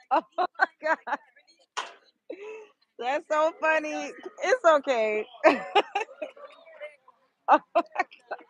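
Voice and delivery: high-pitched, laughing disbelief; nervous laughing. Real, not acted. Telling viewers about a child's tap, nervously laughing. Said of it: "I'm laughing because I don't know how else to react".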